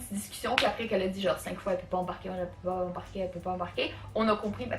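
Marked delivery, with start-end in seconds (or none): 1.7-3.9 low, soft, flat repeated refusal; a droning broken-record refusal; mimicking the agent. Mimics the stubborn airline agent, soft monotone refusal.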